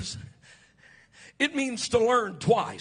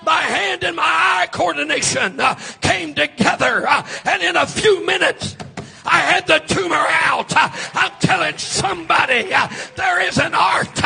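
loud, sing-song preaching with an uh after each phrase; full-force preaching; 5 semitones higher, 9 dB louder than usual. Acted. Preacher to his congregation, shouting sermon. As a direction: build the fire, shouting to the church.

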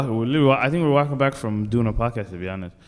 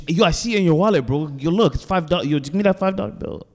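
higher and a bit louder, insistent; pushy panhandler; 6 semitones higher, 3 dB louder than usual. Retold or imitated. Panhandler pushing for the five, a touch higher. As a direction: press him for the cash. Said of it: "He goes".

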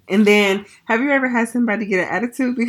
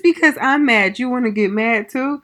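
mild mock scolding; 5 semitones higher, 4 dB louder than usual. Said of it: "And I'm like".

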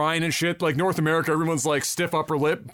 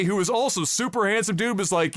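ordinary delivery

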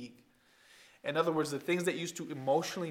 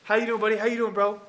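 upbeat greeting; 4 semitones higher, 7 dB louder, faster than usual. Retold or imitated. Mimics friends greeting casually.

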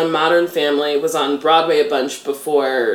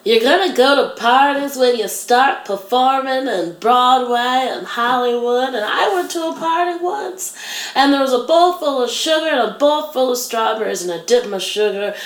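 much higher put-on teacher voice telling a wild tale; imitating teacher's story; 7 semitones higher than usual. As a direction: tell the party story in her odd voice. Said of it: "She goes".

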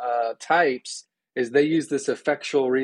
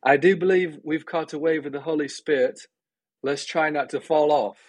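imitated British accent, pitched higher; higher-voiced mock British quote; Graham Cray, British accent; 5 semitones higher than usual. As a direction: put on a lofty British accent. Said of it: "says in his beautiful British accent".

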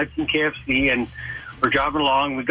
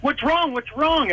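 higher-pitched, playing clueless; feigned innocence; 8 semitones higher than usual. Driver to his gagging buddy, feigning innocence. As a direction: act as if you have no idea. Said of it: "I'm pretending, I'm playing, pleading the fifth".

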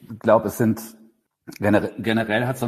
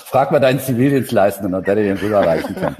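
real laughter through a louder jibe; laughing tease; 5 dB louder, faster than usual. Real, not acted. Colleague teasing the other about a missing note, laughing.

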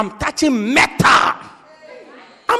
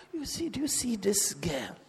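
hushed, secretive matchmaker's whisper; confidential whisper; 14 dB quieter than usual. Acted. Pastor quietly sounds out a brother about a girl, whispered. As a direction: whisper it discreetly, as if to one person.

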